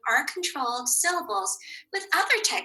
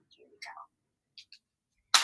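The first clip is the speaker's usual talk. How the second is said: ordinary delivery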